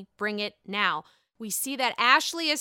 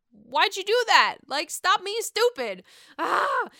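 high, loud scold; exasperated scolding; 8 semitones higher, 6 dB louder than usual. Real, not acted. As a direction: tell her off like a friend. Scolding Karen on screen.

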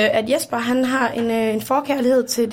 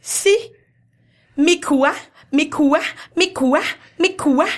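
repeated pretend-Japanese cry, creeping up in pitch; mock Japanese; 4 semitones higher, slower than usual. Performing a manga story for the guests, mock Japanese. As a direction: chant it in fake Japanese, each a little higher. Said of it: "Hun tager den på japansk".

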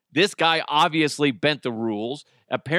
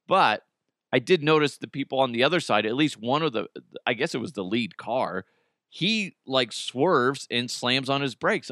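normal talking voice

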